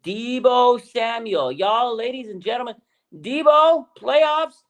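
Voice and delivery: high, stretched-out name rising to a shout; building hype. Real, not acted. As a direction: build from excited announcing to a soaring holler. Hyping his favorite player, building up.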